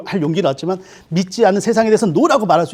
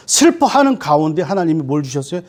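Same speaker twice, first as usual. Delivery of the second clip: ordinary talk, nothing marked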